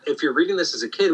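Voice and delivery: ordinary talk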